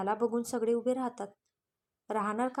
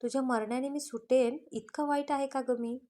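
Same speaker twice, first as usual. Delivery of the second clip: plain reading voice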